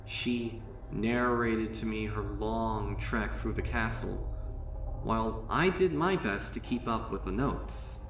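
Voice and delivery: usual speaking voice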